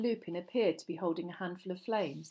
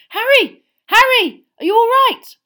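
much higher, loud frantic calls; calling out; 20 dB louder than usual. A narrator's reading. Friend shouts at fainted Harry, frantic loud calling. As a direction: shout his name to wake him. Shaking unconscious Harry awake.

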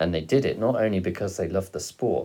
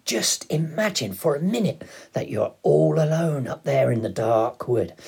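high, loud and dramatic storytelling; excited father; 8 semitones higher, 6 dB louder than usual. A narrator's reading. Father painting the dark wood for his son, excited. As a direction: build the thrill, voice raised. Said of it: "leaping off the bunk and waving his mug".

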